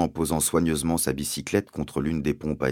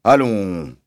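ponderous sergeant, louder than narration; police sergeant; 3 semitones higher, 7 dB louder than usual. Acted. Sergeant addressing nobody in particular, ponderous and loud. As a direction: draw it out like a village policeman.

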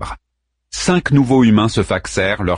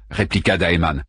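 plain reading voice

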